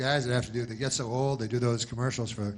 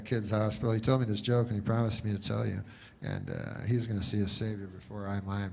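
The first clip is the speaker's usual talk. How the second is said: ordinary delivery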